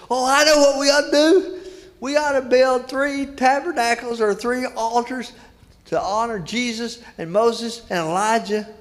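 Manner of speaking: high, eager outburst; impetuous Peter. Retold or imitated. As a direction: blurt it out eagerly, pitched well up. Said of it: "oh, impetuous Peter, Peter pops up".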